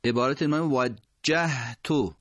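slow وجهتو with a doubled jim; وجهتو with jim held into the ha; careful demo. Shown as a demonstration.

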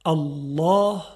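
الله with a thick, emphatic double lam; heavy lam. Shown as a demonstration. Teacher models الله with a heavy lam.

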